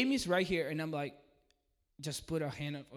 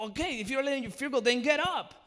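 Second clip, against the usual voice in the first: excited command; 8 semitones higher, 7 dB louder than usual. Retold or imitated. Commanding woman to stand.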